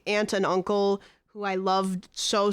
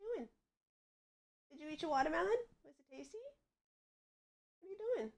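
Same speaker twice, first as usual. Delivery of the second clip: pet talk; 8 semitones higher, 16 dB quieter, much faster than usual. Real, not acted. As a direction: coo gently at the pet. Talking to her pet.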